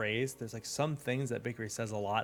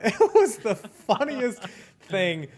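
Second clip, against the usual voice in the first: laughing through the words, slow and loud; can barely get it out for laughing; 9 semitones higher, 9 dB louder, much slower than usual. Real, not acted. Laughing while telling banana story.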